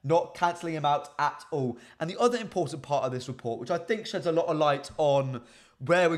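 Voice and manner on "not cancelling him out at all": normal talking voice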